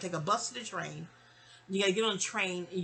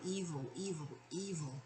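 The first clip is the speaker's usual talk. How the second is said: soft, lowering imitated grumble; retold grumbling; 8 dB quieter than usual. Brother grumbling all through the ride, retold grumbling. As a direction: repeat it as a fading grumble. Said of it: "he just kept grumbling about".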